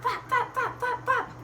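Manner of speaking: high, quick fluttering flaps; flapping chicken. Acted. Storyteller shows Chicken flapping, high flutter.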